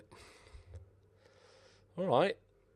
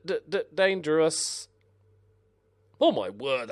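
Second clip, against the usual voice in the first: normal talking voice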